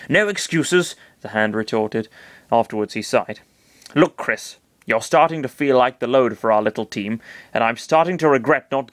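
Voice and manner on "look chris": ordinary delivery, nothing marked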